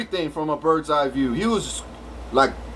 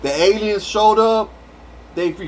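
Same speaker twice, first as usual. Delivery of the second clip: normal talking voice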